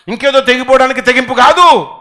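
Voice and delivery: usual speaking voice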